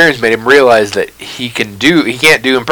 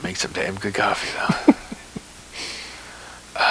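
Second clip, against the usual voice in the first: much softer, thrown away under the breath; barely audible; 3 semitones lower, 13 dB quieter than usual. Retold or imitated. Co-host slipping the coffee joke in, barely audible. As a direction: mutter it so it's almost not heard. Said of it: "just barely ... I almost didn't hear it".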